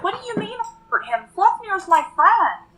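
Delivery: high-pitched, disbelieving character voice; indignant protest. Acted. Acted protest in a quarrel.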